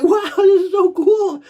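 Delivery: high, loud, slow wonder with laughter; re-enacted elation, laughing through it; retold amazement. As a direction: draw it out, giddy and thrilled. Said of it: "You're like".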